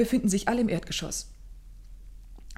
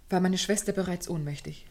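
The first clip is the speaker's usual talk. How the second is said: normal talking voice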